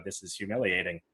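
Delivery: a flat, mortified aside to camera; deadpan to camera. Retold or imitated. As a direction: mutter it straight to the lens, embarrassed. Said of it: "you look at the camera and you go".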